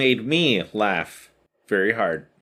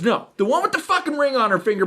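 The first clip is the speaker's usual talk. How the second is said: put-on higher, quick kid punchline; smug little Johnny; 12 semitones higher, faster than usual. Johnny correcting the teacher, high and quick. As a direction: fire it off like a cocky kid. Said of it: "And he goes".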